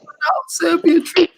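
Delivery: high, slow mock outburst; losing it, imitated. Retelling a streamer's reaction, drawn-out mock outburst. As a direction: stretch it out in a high imitation of outrage. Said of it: "he lost it".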